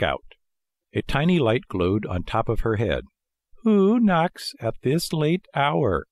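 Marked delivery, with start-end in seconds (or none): 3.6-6.1 slow, raised call from a window; drawn-out snail's call. A narrator's reading. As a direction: call down slowly, pitched up. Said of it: "she called".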